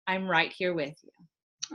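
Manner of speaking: slow, soft and soothing; gentle slow reassurance; soothing, empathetic. Shown as a demonstration. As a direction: say it slowly, like settling a scared child. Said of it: "is to say in a soothing, empathetic voice".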